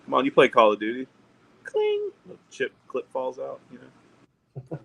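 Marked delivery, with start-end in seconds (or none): 1.6-2.1 high-pitched ringing "cling"; short, high ping sound effect; imitated metallic cling. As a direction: mimic a metal clip popping out. Imitates a rifle clip ejecting.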